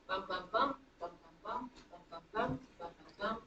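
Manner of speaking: groups of "bum, bum, bum", each leaning on the third; anapestic beat. Shown as a demonstration. Teacher drumming out the meter for students, rhythmic stress. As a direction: chant "bum bum BUM" in steady repeated groups. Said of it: "you feel it".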